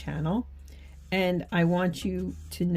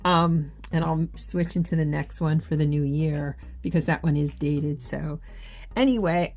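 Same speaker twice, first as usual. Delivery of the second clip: ordinary delivery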